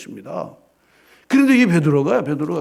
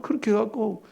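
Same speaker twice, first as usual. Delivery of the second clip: normal talking voice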